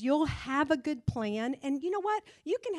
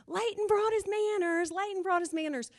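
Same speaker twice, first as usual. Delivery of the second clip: high, bright sing-song chant, much higher; sing-song cheer; 9 semitones higher than usual. Retold or imitated. Honking the horn to praise the boy, high sing-song cheer. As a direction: cheer him on like a proud coach.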